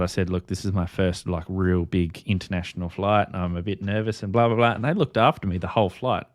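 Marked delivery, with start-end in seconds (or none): none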